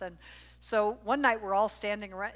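ordinary talk, nothing marked

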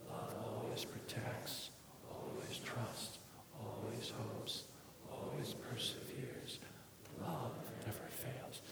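whispered scripture, soft and breathy; hushed reading. Acted. Pastor opening a sermon, whispering scripture to the congregation. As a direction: breathe the verses almost silently.

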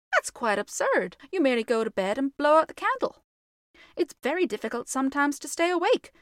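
put-on a bossy little girl, fast; young girl voice. Virginia lectures the ghost.

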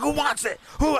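high, shouted fighting challenge; crazed challenge. Retold or imitated. Facing down a crackhead gang, shouting a crazed challenge. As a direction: pick a fight you can't lose. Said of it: "I just went crazy".

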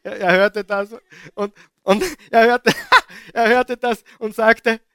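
loud, high, laughing through the words; can barely get it out for laughing. Real, not acted. Preacher cracking up at the verse before the church, laughing loudly. Preacher delighted retelling scripture.

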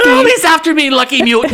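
high, loud mock Irish cereal-mascot voice; Lucky the leprechaun. Mocking Banshee as the cereal leprechaun, high mock Irish. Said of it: "this is just Lucky".

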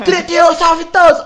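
high, loud triumphant yell; kids' game shout. Retold or imitated. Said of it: "metia o pé na lata e".

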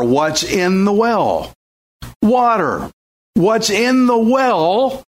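slow, deliberate questions and answers; slow rhetorical questions. Acted. Preacher asking the congregation slowly about the well. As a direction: draw the answers out of the crowd slowly.

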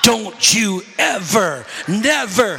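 slow, each syllable hit hard; beat out in syllables. Retold or imitated. Mother scolding her son during a whipping, slow hammered syllables. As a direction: spank out every syllable of the warning. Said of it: "my mother used to beat me in syllables".

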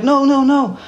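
high-pitched mock panicky refusal; retold alarm. Retold alarm amid traffic.

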